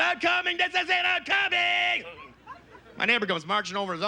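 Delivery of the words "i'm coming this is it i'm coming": high, drawn-out climax scream; hammed-up ecstatic screaming. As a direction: scream it high, stretching to a long wail. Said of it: "She's screaming".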